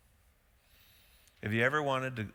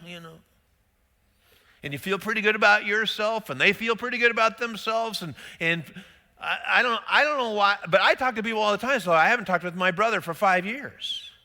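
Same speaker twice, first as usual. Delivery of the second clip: higher, louder, driving sermon voice; preaching at full force; 9 semitones higher, 6 dB louder than usual. Acted. Pastor pleading with his congregation, fervent preaching. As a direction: press the point home to the congregation.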